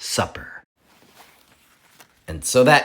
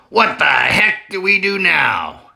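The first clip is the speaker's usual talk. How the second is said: higher, louder character voice; frustrated farmer; 7 semitones higher, 5 dB louder than usual. Acted. Farmer asking the others, exasperated.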